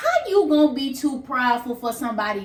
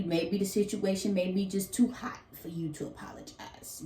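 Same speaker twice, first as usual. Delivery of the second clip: hushed whispered aside; 2 semitones lower, 8 dB quieter than usual. Real, not acted. Confiding softly to viewers in a whisper. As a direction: drop it to a whisper.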